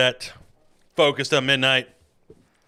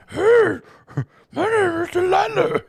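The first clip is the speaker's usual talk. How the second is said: much higher falsetto [name] playing a woman; 6 dB louder than usual. Shown as a demonstration. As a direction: push [name]'s voice way up into a lady's. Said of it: "try and raise my voice".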